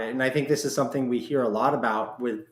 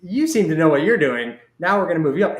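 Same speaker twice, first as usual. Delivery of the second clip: breezy boss quote; 5 semitones higher, 6 dB louder than usual. Retold or imitated. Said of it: "they just go".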